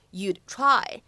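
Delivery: "you'd try" with the d held, not released; "you'd try" with an unreleased d, no burst; incomplete plosion. Shown as a demonstration. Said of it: "不完全爆破的处理".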